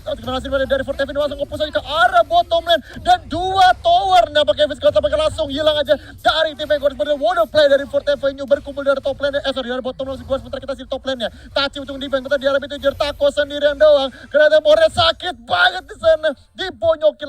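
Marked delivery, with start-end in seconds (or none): 0.1-9.4 excited casting. Real, not acted. Push on two towers. 11.5-17.3 excited casting. Real, not acted. Fight breaks out.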